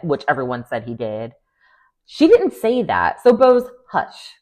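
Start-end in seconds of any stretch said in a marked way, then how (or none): 2.1-4.3 high, slow, sassy telling-off; slow, high-pitched hush, dripping with attitude; exasperated scold. Real, not acted. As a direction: shush her like a fed-up friend. Scolding a cast member on the show.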